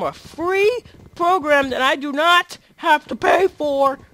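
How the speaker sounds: loud, high and insistent; emphatic plea. Real, not acted. Vlogger begging viewers for a free program, emphatic. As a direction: push each point up and out loud.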